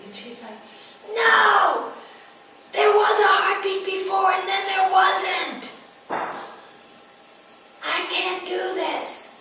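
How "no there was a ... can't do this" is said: high, loud desperate cry of no; panicked shout. Retold or imitated. Mother cries out against the midwives in panicked terror. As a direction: shout it out in raw panic, then slow on the last words.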